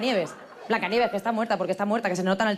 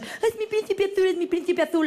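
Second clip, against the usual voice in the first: mock Disney princess; 10 semitones higher than usual. Comedian mocking a dreamy Disney princess, high and swooning. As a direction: swoon it like a cartoon princess, much higher. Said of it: "todo por culpa de las pelis de princesas".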